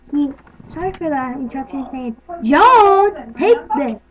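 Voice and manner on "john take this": shouting. Real, not acted. Calling out to someone in room.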